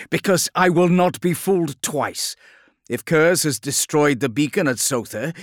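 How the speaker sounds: loud, high, forceful retort; frustrated. A narrator's reading. The Lion refusing to be fooled twice, frustrated. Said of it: "gave vent to his pent-up frustration".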